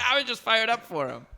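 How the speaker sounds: a hint of eager Rodman; mock Dennis Rodman. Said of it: "He was just".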